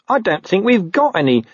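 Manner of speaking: high-pitched, hesitant reply; squeaky, apologetic underling; meek corporal. Acted. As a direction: break bad news to the boss. Nobby meekly answers captain.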